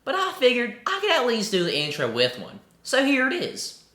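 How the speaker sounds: playful mock Southern accent. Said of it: "do this video in a southern accent".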